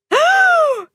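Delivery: sharp high gasp of shock; surprised gasp. Acted. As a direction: gasp in sudden recognition. Monster claps paw over mouth.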